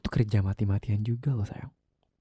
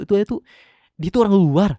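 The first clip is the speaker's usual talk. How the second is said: furious shout; 8 semitones higher, 9 dB louder than usual. Acted. Husband furious about the ex.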